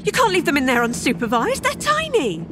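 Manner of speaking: high, alarmed protest without much volume; panicked yell. A narrator's reading. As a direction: protest in panic, pitch shooting up. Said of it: "I yell at the elf, suddenly panicked".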